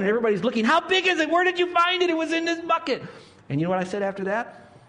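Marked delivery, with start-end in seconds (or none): 0.7-3.0 high, breathless-crowd excitement; excited clamour. Retold or imitated. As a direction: call out like eager onlookers, pitched high. Retold onlookers crowding round the find.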